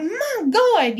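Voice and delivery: loud, high retold exclamation; exasperated outburst. Reacting to the threat to call cops, exasperated.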